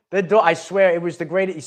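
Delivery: ordinary delivery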